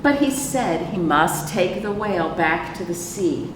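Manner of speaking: normal talking voice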